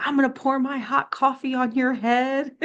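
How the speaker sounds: high-pitched comic threat; mock mad lady. Angry lady threatening a preacher, mock mad. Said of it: "She was just so mad".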